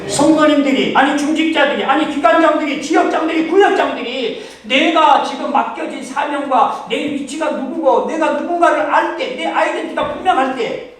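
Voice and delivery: loud, rising preacher voice; loud fervent sermon build; fervent preaching. Acted. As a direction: build it up, one title after another. Preaching at full force.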